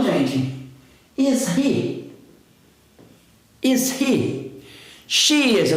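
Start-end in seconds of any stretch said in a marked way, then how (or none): 1.2-2.1 "Is he?" high, curious question tune; question intonation. Shown as a demonstration. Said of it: "Dá essa entonação de pergunta". 3.6-4.4 "Is he?" pitched up as a curious question; question intonation. Shown as a demonstration. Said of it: "Dá essa entonação de pergunta".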